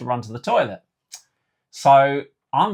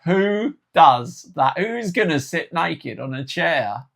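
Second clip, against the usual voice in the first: incredulous, raised and drawn-out questioning; mock disbelief; 5 semitones higher, 4 dB louder than usual. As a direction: stretch out the question in comic disbelief. Acts his disbelief at the instructions.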